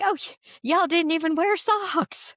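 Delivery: high-pitched imitation of an adorable Southern man; North Carolina Southern accent. Man remarks on traveler's bare feet, North Carolina Southern accent. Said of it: "most adorable North Carolina Southern accent".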